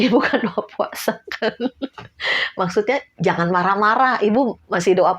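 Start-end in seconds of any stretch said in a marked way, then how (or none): 0.1-1.5 laughing while quoting; laughing repeat. Real, not acted. (mengulang sambil tertawa)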